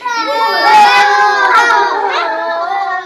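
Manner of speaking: big real burst of laughter; loud laugh. Real, not acted. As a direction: laugh out loud and high at the funny remark. Teacher laughs at child's remark.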